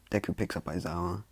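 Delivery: usual speaking voice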